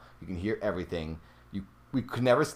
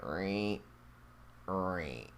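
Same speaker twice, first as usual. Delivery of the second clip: put-on copying the ghostly creak for his girlfriend; replicating creaks; 2 semitones lower, 11 dB quieter than usual. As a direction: mimic the floorboard creak quietly. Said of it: "I was replicating".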